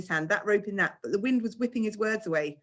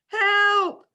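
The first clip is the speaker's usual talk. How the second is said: high, loud frightened yell; a terrified boy calling from the rock; scared cry for help; 14 semitones higher, 8 dB louder than usual. A narrator's reading. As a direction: call for help in a panic. Boy stuck on the cliff.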